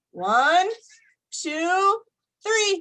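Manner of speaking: put-on rising playful countdown for kids; building excited count; counting up, climbing. Shown as a demonstration. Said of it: "I'll count to three so you can wiggle".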